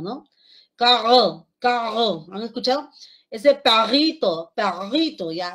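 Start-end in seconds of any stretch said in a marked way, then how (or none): 0.8-2.3 carro with a throaty uvular r, louder; carro said like a child with rhotacism. Shown as a demonstration. Teacher imitating a child's uvular r in carro. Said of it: "niños con rotracismo". 3.3-5.3 perrito with a throaty r, first take loud and slow; uvular r. Shown as a demonstration. Teacher imitating a child's throaty r in perrito. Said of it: "Esa, esa R ya no es R".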